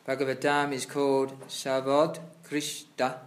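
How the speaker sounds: loud, formal reading of scripture; reading aloud. Acted. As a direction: read the passage out like a sermon. Reads purport aloud louder.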